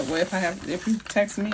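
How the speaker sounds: ordinary talk, nothing marked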